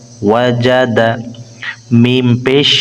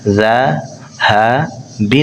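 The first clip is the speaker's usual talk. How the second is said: slow drawn-out demo; much slower than usual. Shown as a demonstration. Teacher to students, very slow drawn-out model reading. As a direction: stretch ذهب out very slowly, each letter held. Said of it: "teacher models word slowly".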